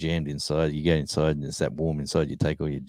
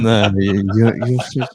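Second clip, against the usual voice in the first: louder, higher put-on lady's voice, gushing; Big Betty's voice; 5 semitones higher, 8 dB louder, slower than usual. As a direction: gush at him like a flirty lady at a bar. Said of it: "she's going".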